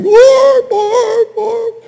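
high, loud howling whoas; imitated dog howl. The hurt dog howling after flipping over, high and loud. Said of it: "he's going".

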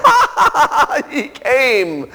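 high, slow mock laughter from a crowd; imitated crowd laughing at the visitor. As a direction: laugh at him like the whole dorm. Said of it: "burst into laughter and say".

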